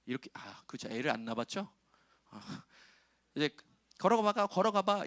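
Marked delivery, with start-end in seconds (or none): 4.0-5.0 put-on high, louder cheering on a child; a hint of an eager parent; encouraging parent. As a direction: urge the toddler forward. Parent urging toddler to walk.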